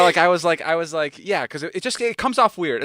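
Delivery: ordinary delivery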